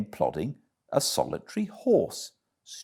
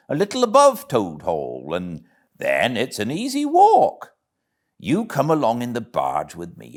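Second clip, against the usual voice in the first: a lightly voiced, hearty barge woman, a touch higher; 6 semitones higher, 5 dB louder than usual. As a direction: hail the passer-by like a friendly bargewoman.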